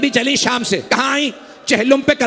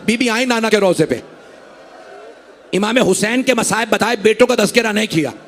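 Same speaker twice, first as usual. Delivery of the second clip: ordinary talk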